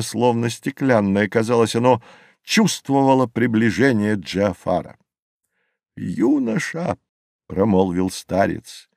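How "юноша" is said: put-on high, soft, slow old man; solemn old sage. Old man addresses his young saviour, high solemn voice. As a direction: call softly in a high, aged voice.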